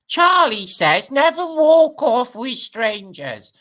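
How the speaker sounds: high, slow cartoon-narrator voice; slow, high mock cartoon voice; Charlie Says impression. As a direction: mimic the old public information film, drawn out high.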